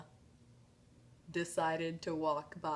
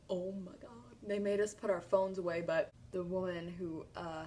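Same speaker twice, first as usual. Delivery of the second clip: ordinary delivery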